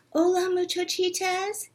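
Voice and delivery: high and soft sheepish greeting; muy soft voice. Acted. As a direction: say it softly, caught out. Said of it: "in a muy, muy soft voice".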